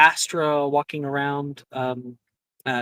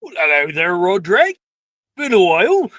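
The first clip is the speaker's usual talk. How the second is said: put-on louder booming hello to an old friend; hearty greeting; 3 semitones higher, 7 dB louder than usual. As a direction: greet him big and hearty. Hagrid greets old student.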